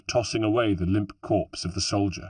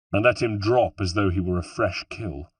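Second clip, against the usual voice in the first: ordinary delivery, nothing marked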